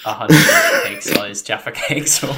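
loud and high through laughter; can barely get it out for laughing. Real, not acted. Absurd question, bursting into laughter.

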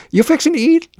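very high-pitched Oklahoma twang; Oklahoma accent. Retold or imitated. Restaurant staff taking an order, hammed-up Oklahoma accent. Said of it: "learn to speak English in Oklahoma".

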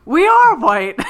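high, loud dopey announcement; imitating Jason's line. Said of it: "Jason goes".

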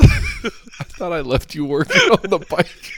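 high, slow mock scolding; harsh barking commands. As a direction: scold his wife, hammed up.

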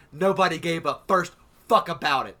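loud, furious, building to a shout; venting real outrage at fake mourners; angry rant. Real, not acted. Ranting to co-hosts about fake mourners, loud and furious.

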